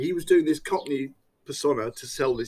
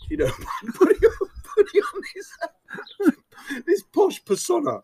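laughing; 13 semitones higher, 4 dB louder than usual. Real, not acted. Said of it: "laughing while telling".

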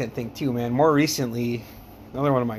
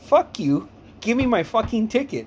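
raised, punchy comeback; mock-indignant, pitched up; retold angry retort; 8 semitones higher, 3 dB louder than usual. Retold angry retort to rude lady.

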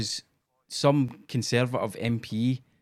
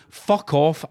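normal talking voice

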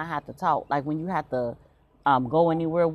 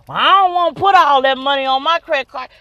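louder, higher put-upon dad; daddy protesting; 6 semitones higher, 8 dB louder than usual. Retold or imitated. Daughter voicing her dad as he balks on the phone, louder and higher. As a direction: protest like a dad guarding his credit card.

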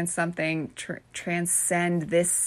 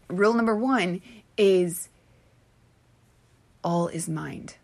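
usual speaking voice

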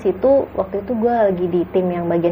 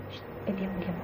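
hushed and much softer, nearly a whisper; 16 dB quieter than usual. Retold or imitated. Hushing Neng on the dark trail, much softer urgent shush. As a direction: shush her urgently so nothing hears. Hushing friend near ghost.

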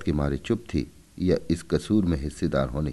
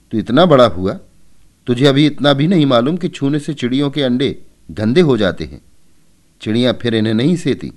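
loud, fast-ending reproach; scolding mother; 6 semitones higher, 9 dB louder than usual. A narrator's reading. Mother scolds Keshav for touching the eggs, loud reproach. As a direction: tell off a son who should know better.

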